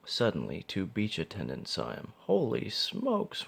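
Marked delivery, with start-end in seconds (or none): 2.2-3.5 high, slow astonished gasp. A narrator's reading. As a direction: gasp it out in shock. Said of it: "one of them gasped".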